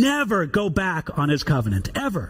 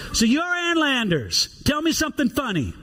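lightly voiced southern drawl, higher; hint of a slow southern drawl; 7 semitones higher than usual. Senator teasing Ann Landers in a light southern drawl. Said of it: "says kind of in a southern drawl".

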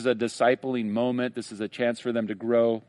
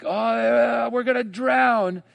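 panicked disciples; 10 semitones higher, 3 dB louder, slower than usual. Retold or imitated.

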